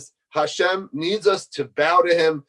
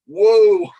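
taken aback; 4 semitones higher, 9 dB louder than usual. Retold or imitated. Teacher reacting to the outburst.

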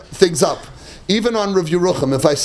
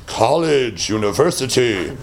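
a hint of a low, slow self-satisfied boast; lightly voiced smug answer; 9 semitones lower, slower than usual. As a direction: list your degrees, drawing it out. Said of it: "So, I would say".

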